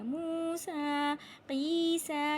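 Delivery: slow, measured chant; recitation demo. Shown as a demonstration. Teacher showing where alif is silent, slow recitation. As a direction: draw out the long vowels. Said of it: "यहाँ भी या को नहीं पढ़ा जाएगा".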